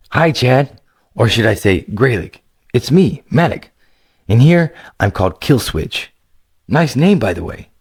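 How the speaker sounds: a playful gamer buddy greeting; friend Killswitch. Acted. Narrator voices friend Killswitch.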